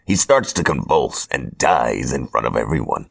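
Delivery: ordinary delivery, nothing marked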